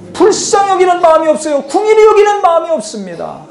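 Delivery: high insistent preaching; emphatic answer. Real, not acted. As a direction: proclaim the missing thing. Answer to his own question.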